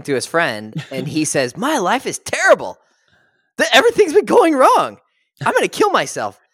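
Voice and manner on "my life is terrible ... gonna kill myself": high, loud, hammed-up wail of a man at the end of his rope; mock despair. To his friend, the novel's hero in hammed-up high, loud despair. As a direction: play it as over-the-top misery. Retelling a despairing character's line.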